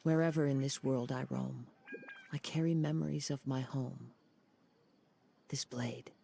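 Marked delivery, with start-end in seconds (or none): 5.5-6.1 breathy whisper of a vow; hushed whisper. Acted. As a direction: whisper it close to the blade. A swordsman's quiet vow.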